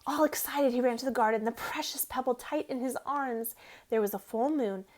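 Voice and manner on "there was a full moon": ordinary delivery, nothing marked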